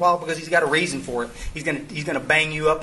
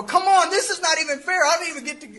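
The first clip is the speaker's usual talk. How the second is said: high, put-upon whine in a much higher voice; whiny complaint; 11 semitones higher than usual. Retold or imitated. To God at the strip club lot, a high whiny protest. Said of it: "And I go".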